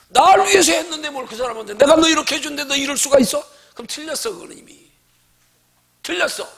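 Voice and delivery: loud hurt protest to God; resentful complainer. Acted. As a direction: complain as if cheated. Voices resentful believer complaining.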